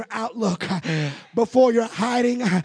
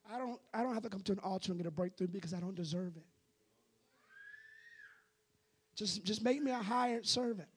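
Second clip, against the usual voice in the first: much softer, small humble voice; timid self-abasing prayer; 3 semitones lower, 12 dB quieter, faster than usual. Retold or imitated. A believer quietly telling God he is unworthy. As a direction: shrink into an unworthy plea. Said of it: "And this is how we talk".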